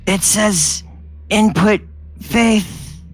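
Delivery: small weak robot voice, slow; a faint voice that hardly gets out; little weak voice. A narrator's reading. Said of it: "little weak voice comes out and says".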